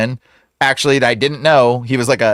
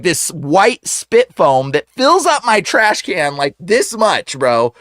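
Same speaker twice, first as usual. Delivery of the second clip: usual speaking voice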